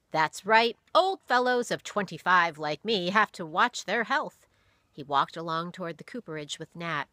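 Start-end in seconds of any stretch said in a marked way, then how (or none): 0.1-4.5 louder, wry, amused pastor; amused chuckle. A narrator's reading. Pastor joking about his age, amused. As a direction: play along with a chuckle. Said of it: "Doctor Bentley chuckled".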